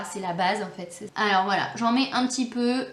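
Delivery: normal talking voice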